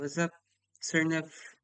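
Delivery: normal talking voice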